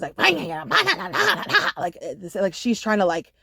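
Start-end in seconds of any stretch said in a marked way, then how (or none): 0.0-2.0 high gibberish chant with a laugh; imitating the muttered incantations as gibberish; mock demonic babble. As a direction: babble nonsense like a weird incantation. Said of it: "those same demonic, like, incantations".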